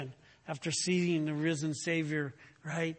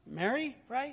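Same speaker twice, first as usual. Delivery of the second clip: puzzled, higher questioning voice; mock-confused townsfolk, pitched up; confused crowd; 7 semitones higher than usual. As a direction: play the baffled crowd asking. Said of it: "They're like".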